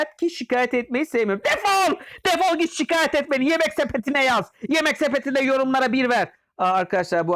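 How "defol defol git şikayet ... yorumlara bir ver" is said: much higher furious yell; angry yell. Acted. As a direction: throw him out with a shout. Shouting at a customer. (öfkeyle bağırarak kovma)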